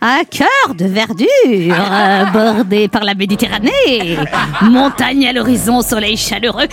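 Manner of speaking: slow, laughing witch. Acted. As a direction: drag it out like a witch. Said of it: "[name] déguisée en sorcière".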